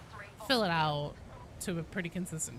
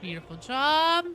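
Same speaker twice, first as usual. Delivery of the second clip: high, loud cheer for the team; delighted praise; 8 semitones higher, 9 dB louder than usual. Real, not acted. Player congratulating team after a fight, delighted praise. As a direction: praise them loudly, pitch sweeping up.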